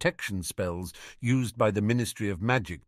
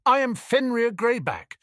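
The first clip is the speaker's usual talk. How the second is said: high, louder proud insistence; indignant; 13 semitones higher, 4 dB louder than usual. Acted. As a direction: declare the name with wounded pride. Werewolf insists on his name.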